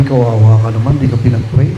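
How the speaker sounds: low mocking sympathy to the neighbor; mock pity. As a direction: tease him with fake sympathy.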